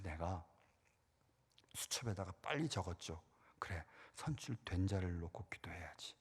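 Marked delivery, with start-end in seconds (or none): 3.6-6.1 whispered, repeating to himself; soft whisper of 선출된 자를 놓고 기도해야지. Real, not acted. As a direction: breathe the line as you note it down.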